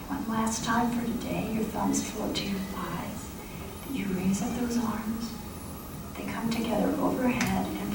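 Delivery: hushed meditation voice, easing them to stillness; closing a qigong class, gently guiding the final movement; soft guided meditation. Acted.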